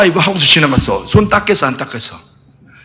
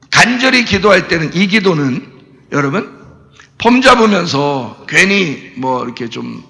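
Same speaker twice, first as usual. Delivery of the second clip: usual speaking voice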